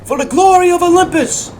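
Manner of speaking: high, loud threat, peaking on the last cry; rally the army for slaughter; battle cry. Acted. Soldiers of Olympus threaten Spartans before battle, fierce war cry.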